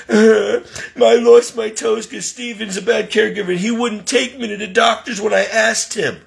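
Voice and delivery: high-pitched imitation of a tattler; put-on high, aggrieved voice; mock victim complaint. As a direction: imitate her playing the wronged patient. Mimics Donna tattling to social workers.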